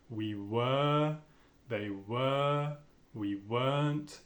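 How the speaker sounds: "were" as a long "wa", no R; lengthened vowel. Shown as a demonstration. Teacher demonstrating long vowels without R. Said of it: "une certaine longueur à la voyelle".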